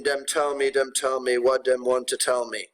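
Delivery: put-on lightly done Jamaican accent, dem for them; a teacher's mild Jamaican accent on dem. Teacher reading a poem to the class in a mild Jamaican accent. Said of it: "apologies for my terrible Jamaican accent".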